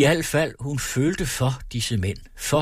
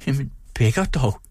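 forced teasing; 4 semitones higher than usual. A narrator's reading. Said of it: "med en tone, der møjsomt dulte et skælmeri".

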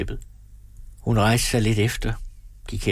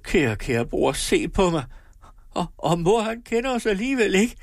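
lamenting; 11 semitones higher than usual. A narrator's reading. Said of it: "klagede hun".

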